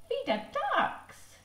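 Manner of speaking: put-on very high squeaky toddler voice; baby voice. Baby asking dad to feed the ducks, high toddler voice. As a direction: pitch it way up like a tiny child.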